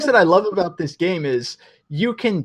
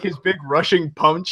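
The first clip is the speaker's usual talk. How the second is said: normal talking voice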